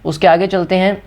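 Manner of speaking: usual speaking voice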